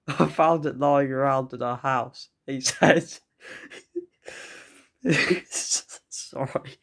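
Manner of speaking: words broken by real laughter; cracking up mid-line; laughing. Real, not acted. The stepdad's line, giggling helplessly through it.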